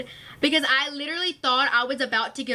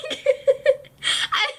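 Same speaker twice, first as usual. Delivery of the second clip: real giggling fit; laughing at her own story; 13 semitones higher than usual. Real, not acted. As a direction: laugh at the memory. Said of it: "laughing at herself".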